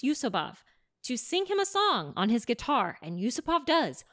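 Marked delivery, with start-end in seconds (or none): none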